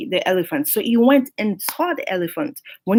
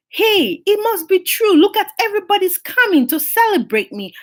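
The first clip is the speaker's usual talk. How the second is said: higher, delighted elephant sure it's all for him; gullible excitement; 8 semitones higher than usual. Acted. Elephant to himself, seeing crowds, higher excited delight. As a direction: gush like you're finally being crowned.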